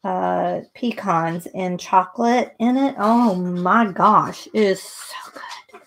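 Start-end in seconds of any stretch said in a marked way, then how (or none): 3.0-5.8 slow drawn-out, trailing into a whisper; slow delighted sigh fading to a whisper; savoring. Real, not acted. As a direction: linger over it, then whisper the praise. Savoring toffee cookies.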